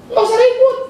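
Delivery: emphatic plea. Acted. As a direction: throw it up high to end the fuss. Pleading to stop fighting.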